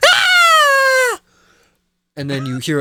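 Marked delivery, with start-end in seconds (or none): 0.1-1.2 very high, loud terrified scream; crowd scream. Retold or imitated. Townsfolk screaming at the charging beast, high terrified scream. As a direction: shriek as if trampled. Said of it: "you hear people scream".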